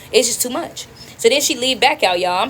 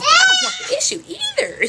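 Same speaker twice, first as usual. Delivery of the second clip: squealed acted protest; pleading squeak; high-pitched protest. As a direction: shoot way up high in exasperation. Acting out the argument.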